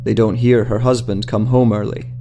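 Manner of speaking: ordinary delivery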